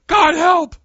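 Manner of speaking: desperate cry. Retold or imitated. Preacher showing his own bullet prayer in trouble, a loud yell. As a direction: cry out in panic. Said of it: "I'm gonna go".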